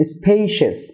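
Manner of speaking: স্পেশিয়াস as "SPAY-shes", falling; correct pronunciation. Shown as a demonstration. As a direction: end স্পেশিয়াস in a short "shes". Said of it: "শেষ বা". (স্পেশিয়াস-এর সঠিক উচ্চারণ, "স্পেইশেস")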